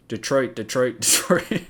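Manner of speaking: cracking up mid-chant; giggling through a flubbed chant; laughing slip-up. Real, not acted. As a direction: lose it as the word goes wrong. Tongue slips, breaks into laughter.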